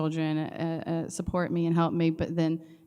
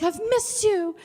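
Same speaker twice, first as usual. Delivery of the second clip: put-on high, sweet little kid; child voice; 3 dB louder than usual. Teacher imitating her student's sweet high voice. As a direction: say it like a small boy who missed her.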